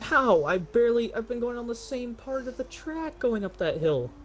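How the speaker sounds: high, outraged protest; can't believe the penalty; indignant disbelief. Real, not acted.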